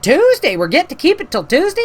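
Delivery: high, incredulous retold question; surprised questioning. Homeowner learning the porta potty stays till Tuesday, high surprise.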